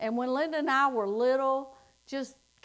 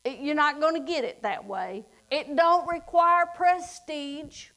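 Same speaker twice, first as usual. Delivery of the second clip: higher, louder preaching climax; 3 semitones higher, 4 dB louder than usual. Acted. Preacher tells the congregation salvation is free, louder and higher. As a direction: drive it home with force.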